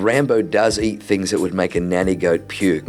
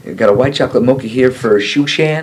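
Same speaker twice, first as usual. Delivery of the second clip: a louder, higher barista calling out through laughter; mock barista call; 5 semitones higher, 6 dB louder than usual. Pastor imitating a barista who garbles his name, laughing and louder. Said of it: "I go into Starbucks and I say my name and they".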